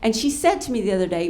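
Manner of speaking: ordinary delivery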